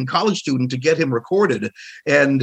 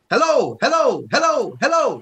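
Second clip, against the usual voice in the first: high ringing hello hello to test the room; acoustics-test hellos; 10 semitones higher than usual. Retold or imitated. Imitating Marvin testing the room, high calling hellos. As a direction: call out high to hear the echo. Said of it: "checking the acoustics".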